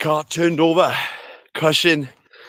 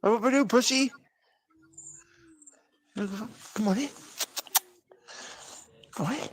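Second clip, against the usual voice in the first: high, soft coaxing to the cat; coaxing pet talk; 12 semitones higher, 4 dB quieter than usual. Real, not acted.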